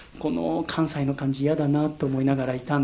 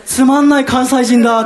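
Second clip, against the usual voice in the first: loud, high, half-laughing disbelief; aghast exclamation; 9 semitones higher, 11 dB louder than usual. Retold or imitated. Recalling seeing the boring Kansai guy run up, loud aghast laugh. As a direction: blurt it out in laughing horror.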